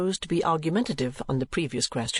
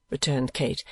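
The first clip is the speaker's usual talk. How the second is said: ordinary delivery, nothing marked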